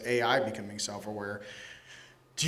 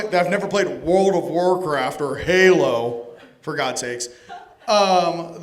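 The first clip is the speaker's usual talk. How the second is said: normal talking voice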